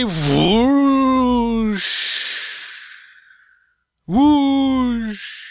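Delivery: long high whoosh; whoosh effect. Pretend. Voicing Fiora's teleport, drawn-out whoosh.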